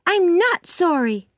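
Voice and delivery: put-on high-pitched stubborn girl; defiant Anne. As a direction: dig your heels in, childishly indignant. Anne refuses to apologise.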